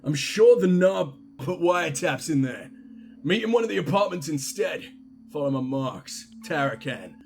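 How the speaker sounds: put-on Cockney character voice. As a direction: read it as a makeshift Cockney. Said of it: "so he's a Cockney now".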